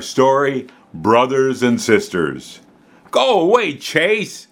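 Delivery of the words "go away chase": stormy. Acted. Girl angrily chasing her little brother off. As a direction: shoo the pest away in a temper. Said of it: "stormed Kayla".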